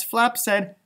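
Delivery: plain reading voice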